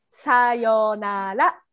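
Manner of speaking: さよなら drawn out slowly, each syllable clear; slow model. Shown as a demonstration.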